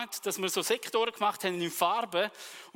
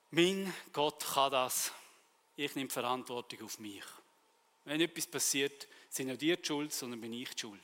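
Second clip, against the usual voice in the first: quiet solemn vow; 6 semitones lower, 6 dB quieter than usual. Retold or imitated. Retold solemn promise, quiet.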